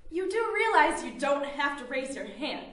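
normal talking voice

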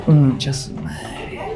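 slow, soft muttered curse; dread. Real, not acted. Job sees his mother with the notebooks, slow soft curse. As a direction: curse under your breath. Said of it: "dread at seeing mother".

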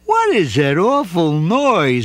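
slow, irritated question; mild annoyance; annoyed bear. A narrator's reading. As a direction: grumble at the racket. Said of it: "he said".

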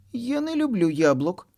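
put-on high sulky child voice; angry child. A narrator's reading. Kitty sulks at mom, high and cross. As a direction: pout and grumble. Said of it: "злоститься Киця Миця".

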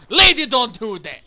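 loud Russian zombie voice; Russian accent. Retold or imitated. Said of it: "He's Russian".

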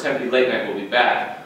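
usual speaking voice

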